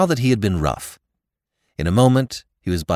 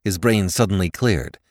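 ordinary delivery, nothing marked

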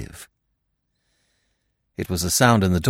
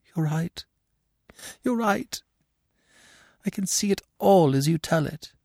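high, choked woman's voice; stricken, strangled admission; 10 semitones higher than usual. A narrator's reading. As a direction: speak through a tight throat, high and small. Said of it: "in a choked voice she said".

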